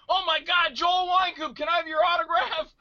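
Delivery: high, loud gushing fan voice; mock starstruck shout. Fan across the street asking for an autograph, starstruck.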